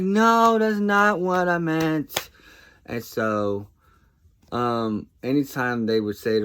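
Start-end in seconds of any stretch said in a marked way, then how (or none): 0.0-2.3 very high, loud, drawn-out mock wail; imitating an exasperated child's loud objection; exasperated protest. Nephew objecting to grandpa's dad joke, exasperated protest.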